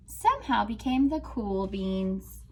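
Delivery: expressive storybook reading; reading to children. Acted. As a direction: read it out like a picture book to a class. Teacher reads picture book aloud.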